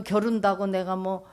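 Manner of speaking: ordinary delivery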